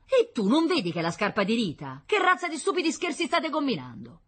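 high, loud exasperated questions; outraged scolding. Acted. Wife sees her daughter's shoe misused, loudly outraged. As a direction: scold them both, rising to outrage.

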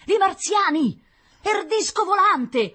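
high, loud cries from the crowd; frantic street shout; panicked shouting. Acted. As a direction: call it out across the courtyard.

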